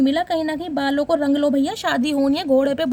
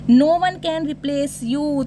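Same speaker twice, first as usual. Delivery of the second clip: slow reading; much slower than usual. Shown as a demonstration. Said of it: "reads card message slowly".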